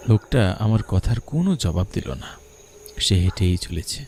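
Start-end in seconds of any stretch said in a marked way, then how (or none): none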